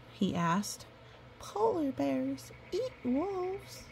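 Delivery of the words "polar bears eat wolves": high, small scared pup voice; frightened pup. Acted. Pup afraid of the polar bear, a high scared voice. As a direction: ask it like a tiny frightened pup.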